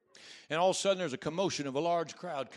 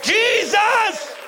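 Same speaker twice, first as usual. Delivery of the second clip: loud high call of the name; shouted plea; cry out; 13 dB louder than usual. Shown as a demonstration. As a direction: lift your voice and cry it out. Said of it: "lift your voice and cry out".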